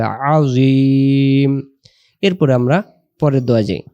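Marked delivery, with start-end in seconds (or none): 0.0-1.5 আজিম held very slowly, ain pressed in the throat; slow throaty আজিম; drawn-out Arabic. Shown as a demonstration. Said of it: "গলা চাপ হবে".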